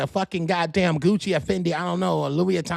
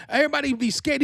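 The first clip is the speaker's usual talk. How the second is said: ordinary delivery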